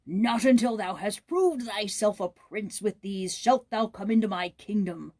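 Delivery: grave royal oath; mighty oath. Acted. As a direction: swear it like a stern king. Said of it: "he swore with a mighty oath".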